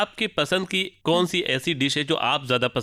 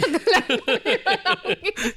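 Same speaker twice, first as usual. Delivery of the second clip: laugh. Real, not acted. Host laughs at guest's joke.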